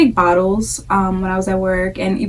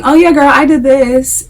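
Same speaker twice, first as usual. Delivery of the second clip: higher, louder imitation of a girlfriend's advice; mock mom-to-mom bragging; 6 semitones higher, 6 dB louder than usual. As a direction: chime in like an overeager mom. Acting out moms trading personal advice.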